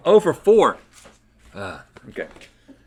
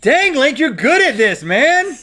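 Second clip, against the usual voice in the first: excited; 14 semitones higher, 4 dB louder, slower than usual. Real, not acted. Impressed by partner's guesses.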